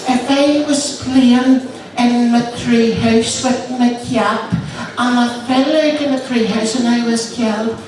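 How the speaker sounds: put-on little boy voice. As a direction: pipe up like a small boy. Child's voice in joke.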